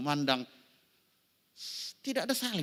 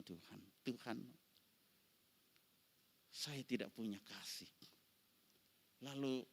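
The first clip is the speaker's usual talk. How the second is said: much softer, lower confession; quiet testimony; 3 semitones lower, 9 dB quieter than usual. Acted. Pastor confessing his failure to the congregation, soft and low. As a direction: draw them in with a hushed voice.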